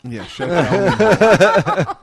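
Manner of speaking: loud laughing joke insult. Real, not acted. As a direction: laugh through it, playfully.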